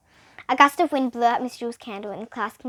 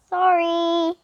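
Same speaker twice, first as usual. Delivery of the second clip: higher small apology; sheepish apology; 7 semitones higher, 4 dB louder than usual. A narrator's reading. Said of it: "he said".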